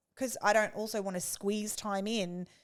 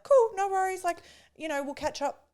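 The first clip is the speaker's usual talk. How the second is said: higher, breezy and relaxed; easygoing friend; 10 semitones higher, 4 dB louder than usual. Retold or imitated. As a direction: toss it off lightly, no hard feelings. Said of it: "they're like".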